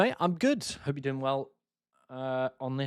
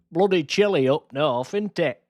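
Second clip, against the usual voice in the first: mock Yorkshire accent; 3 semitones higher, 3 dB louder than usual. Yorkshire accent teasing chat viewers about the cold. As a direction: tease the Yorkshire accent with a light touch. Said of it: "That's how we all speak".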